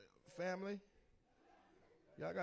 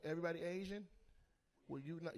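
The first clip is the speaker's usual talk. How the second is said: normal talking voice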